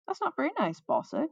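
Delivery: mild scolding, starting high and dropping; chiding. A narrator's reading. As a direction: gently tell her off. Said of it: "Yuka chided".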